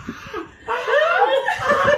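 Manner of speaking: high, loud wild hollering; hammed-up caveman yells; caveman screams. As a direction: howl like a caveman on the loose. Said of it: "como si fuera un cavernícola".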